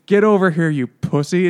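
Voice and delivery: mock pushy summons; mock demanding call. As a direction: bark it at him, hammed-up. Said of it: "she's like".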